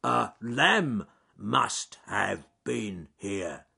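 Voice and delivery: ponderous, drawn-out, loud words; slow bear character. Acted. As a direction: say it very slowly as a lumbering bear.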